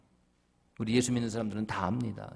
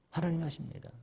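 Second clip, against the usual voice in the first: normal talking voice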